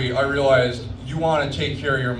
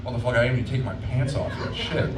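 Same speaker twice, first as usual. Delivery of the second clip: rushed panic; 4 dB quieter, faster than usual. Retold or imitated. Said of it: "It's like".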